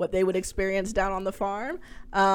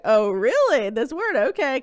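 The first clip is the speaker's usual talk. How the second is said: light mock surprise; 6 semitones higher, 5 dB louder than usual. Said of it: "trying to be a little funny about it".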